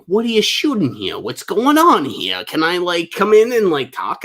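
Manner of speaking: high, pushy onlooker voice; hammed-up nosy passerby butting in; nosy bozo impression. Bozos pestering security at a film set, mock nosiness. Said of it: "a bunch of bozos being like".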